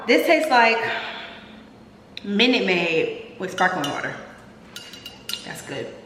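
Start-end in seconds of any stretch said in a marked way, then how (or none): none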